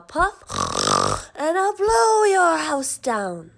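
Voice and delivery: slow, breathy menacing threat; big bad pig threat. A narrator's reading. Pig threatens the wolves at the door, slow and menacing. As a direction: let the threat swell.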